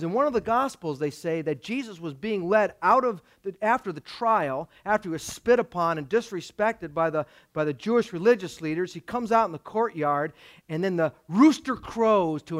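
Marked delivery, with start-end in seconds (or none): none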